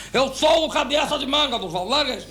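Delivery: high-pitched exasperated proclamation; saying it properly. Retold or imitated. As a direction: blurt it out in full voice, fed up. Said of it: "Não, fala direito".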